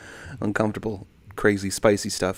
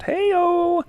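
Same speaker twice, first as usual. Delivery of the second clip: high, drawn-out whoop; gleeful sing-out; playful cheer; 3 dB louder than usual. Real, not acted. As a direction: call it out high in celebration. Playful cheer at viewer's trip news.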